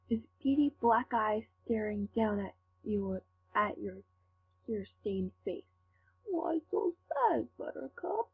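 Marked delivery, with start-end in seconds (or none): 6.3-8.3 high, slow mocking pout. Villain mocks your tears with a high pout. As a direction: taunt her like a sulky child. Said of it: "he pouted mockingly".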